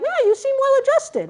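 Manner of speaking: very high-pitched imitation of an observer; mock onlooker. Imitating people looking at her pictures, high-pitched mock onlooker. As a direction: put on a high voice for someone else's easy verdict.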